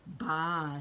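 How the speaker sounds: lightly voiced sheep bleat; a hint of a sheep's baa. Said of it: "says the sheep".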